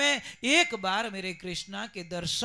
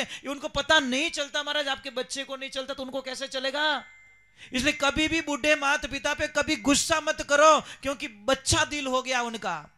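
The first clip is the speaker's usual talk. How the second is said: raised sermon voice, pushing louder; fervent moral appeal; preaching; 4 semitones higher, 5 dB louder than usual. Acted. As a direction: drive the lesson home to the listeners. Preacher lectures audience.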